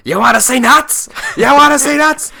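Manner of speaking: high, loud, manic shout; Michael Keaton impression. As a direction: go wild like an unhinged Batman. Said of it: "such a Michael Keaton moment".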